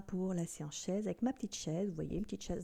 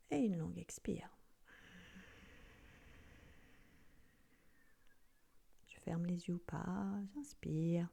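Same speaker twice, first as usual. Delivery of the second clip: guided breathing; 6 dB quieter than usual. Acted.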